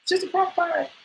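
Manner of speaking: begging roommate with a gun, squeaky panic; mock panic. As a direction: beg the roommate not to shoot. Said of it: "I was like".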